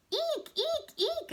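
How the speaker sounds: very high squeaking; hamster squeak. Acted. Humphrey the hamster at the mess, very high squeaks. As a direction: squeak like a tiny frightened hamster. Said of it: "I squeaked".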